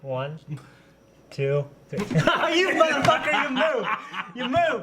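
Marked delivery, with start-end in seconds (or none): 2.0-4.8 high laughing outrage at a dodge; laughing accusation. Real, not acted. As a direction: laugh while calling out the dodge.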